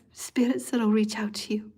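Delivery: soft whispered reassurance; Spirit's hushed comfort. Acted. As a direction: breathe the promise quietly.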